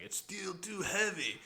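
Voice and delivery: slow, high mock British accent; plummy British pilot in a joke, put on; thick British accent. Retelling a movie joke to cohosts, mock British accent. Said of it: "he has the thick British accent".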